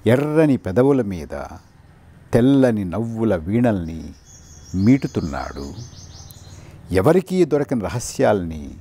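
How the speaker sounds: measured poem reading with long pauses between phrases; unhurried verse, spaced out with silences; poetry recitation. Acted.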